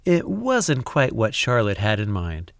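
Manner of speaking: usual speaking voice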